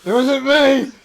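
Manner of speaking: high, loud squeaky denial, slowing down; guilty dog squealing its innocence to its owner; guilty little dog voice. Retold or imitated. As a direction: play a panicked pup protesting innocence. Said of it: "She's like".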